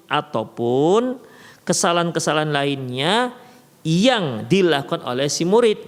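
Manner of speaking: normal talking voice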